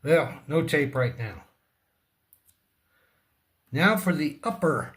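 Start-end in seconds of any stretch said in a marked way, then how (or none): none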